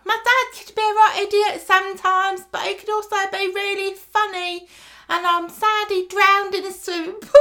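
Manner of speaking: very high, hammed-up orphan voice; high-pitched mock kid's funeral speech; child giving a eulogy. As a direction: recite it as a piping child on one high pitch. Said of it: "say a few words about your dad".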